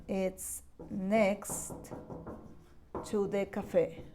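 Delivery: "It's next ... to the cafe" said slowly and softly, with a pause after "next"; slow and soft, chunked for learners; slow modelling. Shown as a demonstration. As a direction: break the phrase into chunks for the class. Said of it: "you can say".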